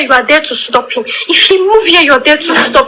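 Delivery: usual speaking voice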